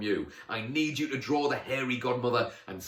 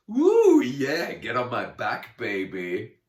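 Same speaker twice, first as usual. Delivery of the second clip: loud, gleeful whooping; excited whoop; 3 semitones higher, 12 dB louder, slower than usual. A narrator's reading. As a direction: whoop it with glee. Excited goat cheers.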